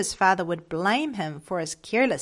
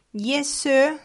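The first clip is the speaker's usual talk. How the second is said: put-on higher, mildly upset boy's answer; a touch of tearfulness, pitch raised; sobbed reply; 6 semitones higher, 4 dB louder than usual. A narrator's reading. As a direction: answer as a tearful boy, understated. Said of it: "sobbed Frank".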